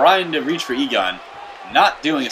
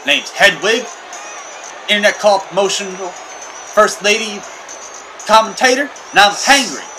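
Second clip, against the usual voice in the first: high character voice; 8 semitones higher than usual. Pretend.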